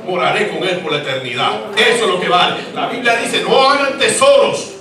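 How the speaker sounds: high, loud, impassioned preaching with a stretched cadence; preaching at full force. Acted. Pastor urging the church to store treasures in heaven, fiery preaching. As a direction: preach it like the roof's coming off.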